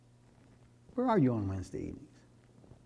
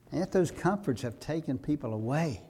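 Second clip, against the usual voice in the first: ordinary talk, nothing marked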